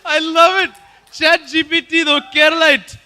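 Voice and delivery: high, loud, thrilled exclamation; delighted shouting. Real, not acted. Host cheers Chat GPT as a Keralite, excited shout. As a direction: burst out with delight.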